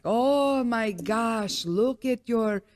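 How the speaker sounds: drawn-out, badly faked South African doctor; bad South African accent. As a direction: do a clumsy South African imitation, stretching the vowels. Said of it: "this is the worst South African in-, imitation".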